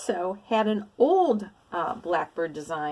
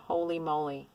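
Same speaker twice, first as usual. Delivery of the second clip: usual speaking voice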